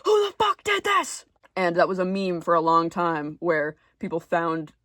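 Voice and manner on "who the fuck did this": much higher, louder screamed question; hammed-up screaming outburst; frantic yell. Mocking Dumbledore in the movie version, frantic yell. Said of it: "In the movie, he's like".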